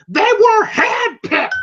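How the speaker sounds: slow, high mock shout; heated retort. Mickey revealing the truth to Rocky, mock shout. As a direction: draw it out loud, imitating exasperation.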